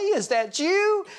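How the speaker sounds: put-on the pastor greets his old teacher with a high squeal; delighted surprise. Pastor greets his old Sunday school teacher, squealing surprise. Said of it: "And I said".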